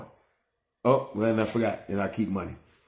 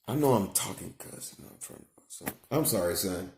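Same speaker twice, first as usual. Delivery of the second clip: soft, lowered aside; hushed apology; 4 semitones lower, 8 dB quieter than usual. Real, not acted. Father apologizing to son for cussing, soft and low. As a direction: drop the volume and apologize tenderly.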